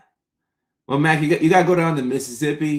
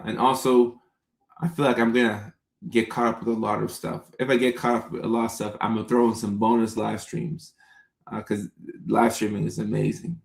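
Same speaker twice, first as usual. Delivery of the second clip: ordinary delivery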